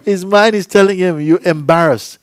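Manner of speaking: loud, high, urgent report; retold outburst. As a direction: keep up her excited outcry. Acts out partner's outburst.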